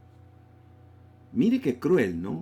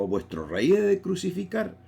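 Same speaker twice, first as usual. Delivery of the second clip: mocking; 8 semitones higher than usual. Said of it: "Como que se estaba riendo, se estaba burlando".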